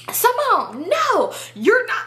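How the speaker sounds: high, drawn-out mock plea; acted friend's protest. Friend stopping Simone, high drawn-out protest.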